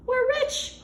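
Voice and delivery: high, loud yell; excited yell. Acted. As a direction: whoop it out. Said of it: "yelled Ryan".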